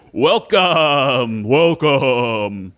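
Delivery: booming, drawn-out arena welcome; fighting game announcer. Acted. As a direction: announce it like a ringmaster. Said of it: "and he says".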